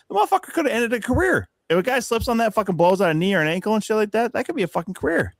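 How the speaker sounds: high, heated outraged rant; high-pitched rant. Retold or imitated. Podcaster ranting about Jamal Murray, pitched high and outraged.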